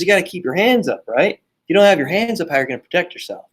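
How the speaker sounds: playing dad teaching a kid boxing; lecturing dad.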